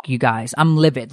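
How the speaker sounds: normal talking voice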